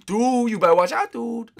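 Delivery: high-pitched mock threat; rival racer warning. Pursuing rival warns the leader, high mock threat. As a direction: warn the leader in a high put-on voice. Voices Tyler threatening from behind.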